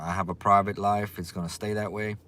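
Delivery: usual speaking voice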